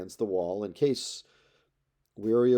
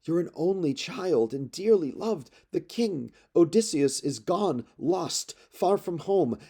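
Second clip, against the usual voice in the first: high, tearful pleading nurse voice, pitch lifted throughout; an old nurse wailing over her boy; wailing, sobbing plea; 7 semitones higher than usual. Acted. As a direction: beg him to stay as if on the edge of tears. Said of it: "began to wail and sobbed".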